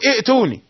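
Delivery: ائتوني with a glottal catch before the t; short first vowel stopped hard in ائتوني; rejected double hamza. Shown as a demonstration. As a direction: show the wrong i'-tuuni for ائتوني. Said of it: "لا تقل".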